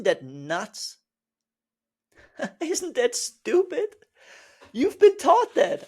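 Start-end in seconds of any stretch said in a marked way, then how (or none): 2.4-4.0 higher, slower, amused; laughing disbelief. Real, not acted. Teacher laughing at the rule, amused. 4.7-5.8 much higher and louder declaration; emphatic. Real, not acted. Teacher hammering the point home, emphatic.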